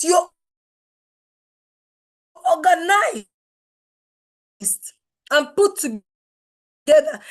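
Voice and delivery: loud and drawn out, pounding each word; emphatic shout. Real, not acted. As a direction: shout it like a rallying call. Coach urging audience to get organized.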